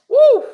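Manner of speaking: loud high whoop of delight; excited whoop. Real, not acted. Preacher whooping at a quote he loves, loud high whoop. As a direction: whoop it out, thrilled.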